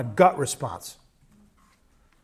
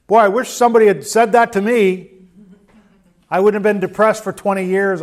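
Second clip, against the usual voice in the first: ironic exclamation; 4 semitones higher, 6 dB louder than usual. Retold or imitated. Speaker's ironic retort quoted.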